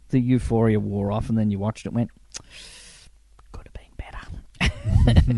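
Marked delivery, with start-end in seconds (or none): 3.5-5.3 slow, high whispered verdict; wry aside. Retold or imitated. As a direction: let it slip under your breath.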